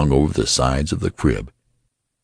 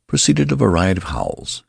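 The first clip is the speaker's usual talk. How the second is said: plain reading voice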